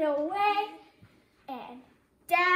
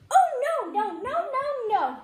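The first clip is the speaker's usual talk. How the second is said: emphatic no; 6 semitones higher, 4 dB louder than usual. A narrator's reading. Narrator's emphatic refusal.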